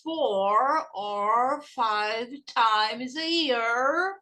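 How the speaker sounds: very slow, each word drawn out, rising at the end; word-by-word classroom drill; repeat-after-me slow. Shown as a demonstration. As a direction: stretch every word for learners to copy. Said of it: "Repeat after me".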